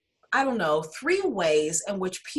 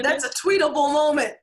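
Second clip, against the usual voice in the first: quick bright exclaim, higher; excited; 7 semitones higher, 4 dB louder, faster than usual. Real, not acted. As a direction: pop the line out fast. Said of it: "what did you say".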